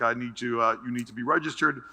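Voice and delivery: lightly voiced guard, low and courteous; a hint of a courteous official; polite security guard. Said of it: "Extremely polite".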